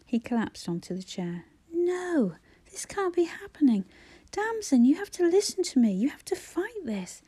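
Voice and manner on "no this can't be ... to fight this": high, urgent begging; a desperate brother begging his dying sister; desperate pleading. Acted. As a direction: plead with her to fight. Brother begs sister to fight.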